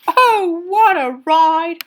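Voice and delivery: high, loud, drawn-out cry; wild exclamation. A narrator's reading. Boy crashing down with his fish, drawn-out exclamation. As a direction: draw it out slowly like a whoop. Falling through the floor.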